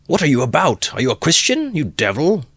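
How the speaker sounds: very high, loud rebuke; old man's outraged shout. Acted. Old man rebuking Mikulka, outraged shout. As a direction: scold him in outrage. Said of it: "shouted an old man".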